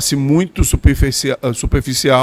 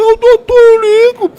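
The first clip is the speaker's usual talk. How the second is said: much higher, louder mock voice on "É o doutor Eurico"; imitating Jair; 8 dB louder than usual. Jair confirming it was the doctor, high mock voice. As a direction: pitch it way up like a friend losing patience. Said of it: "Ele falou".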